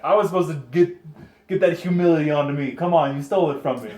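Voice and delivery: loud, playful protest; mock outrage. Real, not acted. Joking at his friend for stealing his line, loud mock outrage. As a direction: complain loudly that he stole your line.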